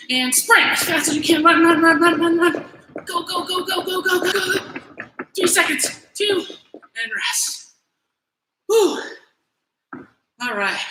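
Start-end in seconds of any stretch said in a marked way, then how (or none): 0.1-6.4 high, loud rapid-fire coaching; energetic trainer's shout, raised pitch; urgent cheering-on. Real, not acted. Coach urging on the sprint. 8.7-9.0 high, loud puff of exertion; winded "whew"; relieved whew. Real, not acted. Winded after the sprint.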